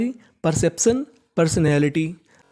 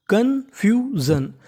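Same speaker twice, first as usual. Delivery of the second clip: Confusion drawn out slowly, pitched higher; slow model word; 4 semitones higher, much slower than usual. Shown as a demonstration. Teacher modelling the word for students, slow and high. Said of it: "तो meaning बन गई".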